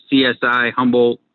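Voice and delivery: ordinary delivery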